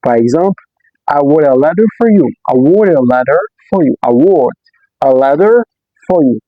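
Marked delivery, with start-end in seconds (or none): none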